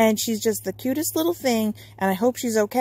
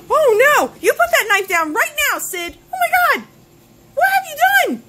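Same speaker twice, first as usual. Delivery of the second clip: high, loud horrified shouting; shocked yelling; 14 semitones higher, 6 dB louder than usual. Acted. Player yells at Sid holding a knife, shocked. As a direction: scream at Sid to drop it.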